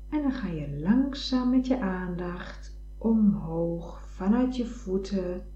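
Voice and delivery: whispering. Acted. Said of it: "whispered meditation guidance".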